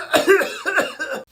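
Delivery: short hacking fit; coughing. Acted. Sick boy answering mother, coughing.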